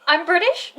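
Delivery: high, loud incredulous question; retold disbelief. Child told they sound British, incredulous. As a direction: squeak it out, rising, in mock shock. Acting shocked reply to classmates.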